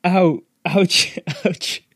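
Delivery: high comic yelps, breaking into laughter; mock pained yelps with a laugh; yelling in pain. A narrator's reading. Caned boy hops past clutching bottom, pained yelping. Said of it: "with both hands and yelling".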